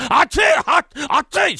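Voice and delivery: loud, high-pitched cries of pain; scalded yelp. Acted. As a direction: yelp as hot food burns you. Servant burnt by hidden hot food.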